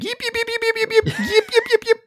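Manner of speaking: high squeaky yips; yappy chihuahua voice. Retold or imitated. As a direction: yip like a tiny excited dog. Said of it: "Can I do the scene from, uh, The Mummy".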